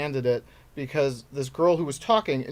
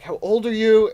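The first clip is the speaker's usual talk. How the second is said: louder, high-pitched mock demand; retold challenge; 7 semitones higher, 7 dB louder than usual. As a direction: throw the question out high and loud. Acting out challenge to audience member.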